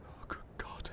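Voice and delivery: barely voiced gasp of horror; horrified whisper. Man uncovering what was buried, horrified whisper. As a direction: whisper it in shock, almost no voice.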